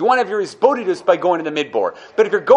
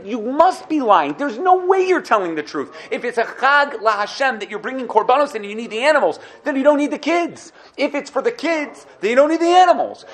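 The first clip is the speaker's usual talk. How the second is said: mock angry Pharaoh; 6 semitones higher than usual. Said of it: "he got angry at Moshe".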